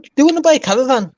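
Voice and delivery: high, fast stage Irish voice; mock Irish traveller accent. Teasing back in a high, fast mock Irish accent. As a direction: blurt it like a hammed-up Irish trader. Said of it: "What top of the morning?".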